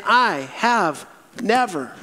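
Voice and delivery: slow, each word of "I have never" set apart; emphatic refusal. Acted. Acting out Peter's firm refusal.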